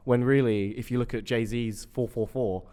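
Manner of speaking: ordinary talk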